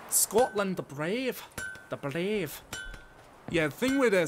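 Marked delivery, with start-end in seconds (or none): 0.1-1.4 emphatic, higher-pitched Scottish demo; harsher Scottish accent. Shown as a demonstration. Streamer showing how Scottish sounds, harsh and emphatic. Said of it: "Scottish is a bit harsher". 1.9-2.6 Scottish "brave" with a flicked tongue; Scottish accent demo. Shown as a demonstration. Streamer demonstrating Scottish r's, tongue flicked on "brave". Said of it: "flick the tongue in a way".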